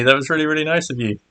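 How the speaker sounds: ordinary delivery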